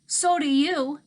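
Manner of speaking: shouted back in anger; hollered. A narrator's reading. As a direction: yell it at him. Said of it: "I hollered".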